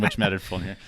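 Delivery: ordinary talk, nothing marked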